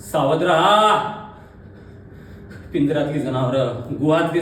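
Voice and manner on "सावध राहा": projected cry rising at the end; loud dramatic alarm, climbing; called-out warning. Acted. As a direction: call the warning across the theatre. Warning repeated as a call.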